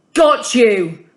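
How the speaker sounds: loud triumphant boom. A narrator's reading. Hussan catching the dog in the van, booming loudly. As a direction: boom it out as you pounce. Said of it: "a man's booming voice".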